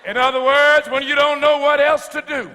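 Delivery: loud, high-pitched, rhythmic whooping climax of the sermon; preaching at full force. Real, not acted. Sermon climax over organ.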